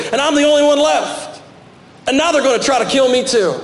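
high, pleading cry of the lone prophet; anguished protest. Acted. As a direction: pitch it up and cry it out. Elijah's complaint, cried out high.

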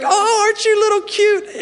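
put-on high sing-song cooing to a baby; baby talk. Parent gushing high baby talk at their infant. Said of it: "you're like".

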